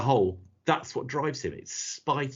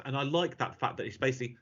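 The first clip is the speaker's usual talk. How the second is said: usual speaking voice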